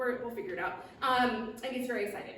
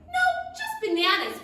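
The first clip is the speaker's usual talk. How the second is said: high dragonfly voice; 7 dB louder than usual. Acted. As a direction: squeak it out like a tiny bug.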